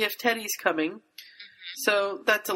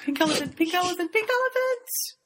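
mounting fixation; 10 semitones higher than usual. Pretend. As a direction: playfully blurt it over and over, rising. Mock obsession with forbidden thought.

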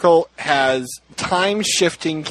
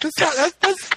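stammering, pitched-up retold protest; mock scandalised; 8 semitones higher than usual. Listener mishears Harry's line as rude, scandalised. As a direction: protest in flustered mock shock.

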